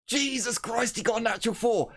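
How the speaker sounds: high-pitched burst of amazement; astonished. Real, not acted. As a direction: react in real shock, voice way up. Astonished at a dice roll.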